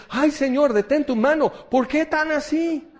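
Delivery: high, loud whiny protest; mock alarmed cry. Believer begging God to stop the breaking, loud whiny protest. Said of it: "y dices".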